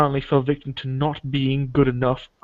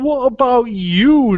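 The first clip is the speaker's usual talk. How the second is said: high slow question; 10 semitones higher, 6 dB louder, much slower than usual. Retold or imitated. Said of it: "I was asking".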